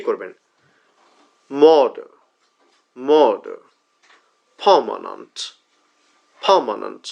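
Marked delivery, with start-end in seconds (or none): 1.5-7.0 murder, permanent with long first vowel, weak schwas; model pronunciation. Shown as a demonstration. Teacher giving words to repeat, murder and permanent non-rhotic. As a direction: say murder and permanent singly, first syllable drawn out. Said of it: "অর্থাৎ আমি বলবো".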